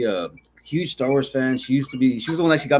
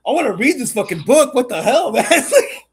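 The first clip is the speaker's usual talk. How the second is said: high, loud excited outburst with laughter; mock-eager, laughing, higher and louder; retold excitement; 10 semitones higher, 7 dB louder, slower than usual. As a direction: relive the hype of hearing the story. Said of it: "And I'm like".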